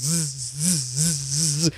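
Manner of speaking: uneven, fading bee buzz; weak buzz on its last legs; sputtering zzz. Shown as a demonstration. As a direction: let the zzz die out like a tired wasp. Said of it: "you don't want to sound like".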